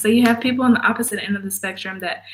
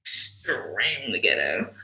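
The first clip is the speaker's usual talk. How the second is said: much lower and softer, slow deadpan; disgusted low aside; 13 semitones lower, 9 dB quieter, slower than usual. Real, not acted. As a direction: mutter it in disgust. Disgusted mutter.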